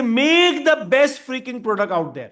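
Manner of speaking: lightly acted, emphatic company command; company's rallying order. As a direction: push it out like a company giving orders. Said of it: "company कहती है".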